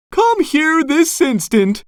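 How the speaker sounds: high-pitched bossy mother; Missus Puff shouting. A narrator's reading. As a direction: order sharply in a high voice. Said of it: "she shouted".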